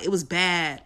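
ordinary talk

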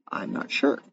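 breathy unsure whisper, under the breath; a whispered shrug; whispered aside. Real, not acted. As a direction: let it slip out as a whisper. Unsure aside after a question.

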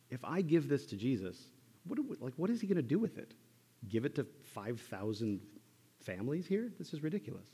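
high, incredulous worry; high fretful incredulity; mock disbelief. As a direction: voice a disciple's panicked doubt. Voicing a disciple's fearful doubt.

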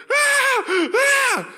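high, loud mock toddler wail; imitated child wail. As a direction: wail like a toddler being picked up. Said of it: "starts losing it".

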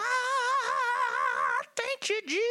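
high-pitched mock Pentecostal wail; Pentecostal shouter. Preacher mimicking himself at a Pentecostal church, hammed-up wail. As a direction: play a worshipper crying out, voice leaping way up. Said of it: "I'm at a Pentecostal church".

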